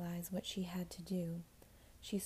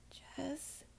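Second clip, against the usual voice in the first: whispered; 7 semitones higher, 5 dB quieter than usual. Acted. Sarah whispers her name.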